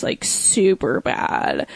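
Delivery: low, slow, creaky fry; vocal fry. Shown as a demonstration. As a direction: drag the words out in deep fry. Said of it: "vocal fry".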